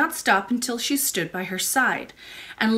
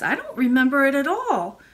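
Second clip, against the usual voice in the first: put-on higher little-girl voice; puzzled child princess; 5 semitones higher, 4 dB louder than usual. A narrator's reading. Princess has no memory of the lady, puzzled.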